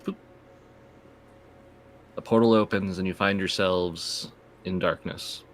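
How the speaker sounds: ordinary talk, nothing marked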